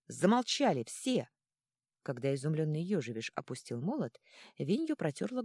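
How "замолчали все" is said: loud, slow barked order; barking. A narrator's reading. Егорай silencing the quarrelling gnomes, loud slow bark. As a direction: bark the command to shut everyone up. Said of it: "рявкнул".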